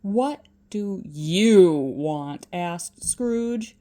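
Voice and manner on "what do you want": low, loud, slow grumble; low, slow miserly growl; grumpy old miser. Acted. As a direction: grumble it at the intruder. Scrooge snapping at his nephew.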